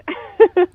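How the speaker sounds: high, loud burst of laughter; delighted laugh. Real, not acted. Guest laughing at her own brand new news, bright laugh. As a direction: laugh out in surprised joy.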